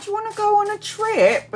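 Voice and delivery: coaxing pitch. Retold or imitated. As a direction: sell the trip in a sweet high voice. Pitching trip to daughter.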